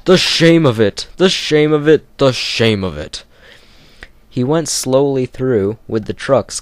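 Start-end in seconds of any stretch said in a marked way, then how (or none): 0.1-3.2 loud, higher grumble fading to plain; sulk, then let it die away; grumbling. Acted. Gordon ashamed of dirty trucks, fading grumble. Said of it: "he grumbled".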